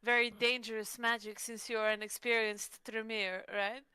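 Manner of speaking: ordinary delivery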